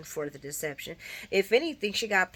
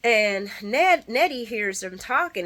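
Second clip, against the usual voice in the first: normal talking voice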